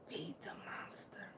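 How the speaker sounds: soft secretive whisper. Real, not acted. Sharing her motto with viewers, whispered. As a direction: hush it like a secret.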